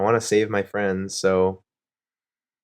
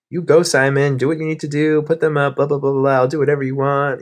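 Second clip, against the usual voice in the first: bright, louder, higher yes-man voice, egging him on; mock eager agreement; 7 semitones higher, 5 dB louder than usual. To Simon on the call, imitating her own louder, higher gushing agreement. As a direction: cheer him on like a pushover. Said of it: "So I was just like".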